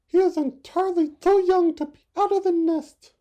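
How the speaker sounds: very high, loud fretting; anxious mother bird. Acted. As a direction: scold like a worried mother bird. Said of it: "cried his mother".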